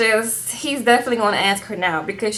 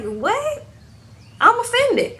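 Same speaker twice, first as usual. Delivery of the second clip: high-pitched mock indignation; scandalized, much higher retelling; offended shock; 12 semitones higher, slower than usual. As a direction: squeal it like you can't believe the nerve. Acting out her shocked, offended reply.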